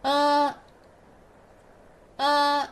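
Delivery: flat-lipped Uh; teacher models Uh for students; pinyin e. Shown as a demonstration. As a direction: spread the lips flat and say Uh. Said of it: "Read after me".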